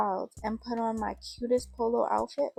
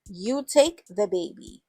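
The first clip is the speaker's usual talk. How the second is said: scolding mother; 8 dB louder than usual. Acted. Mother scolding from her room.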